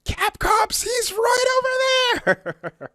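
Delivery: high-pitched yell of a tattletale; mock snitch's shout. Snitching on another player to Capcom, mock high shout.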